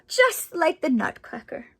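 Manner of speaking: ordinary delivery, nothing marked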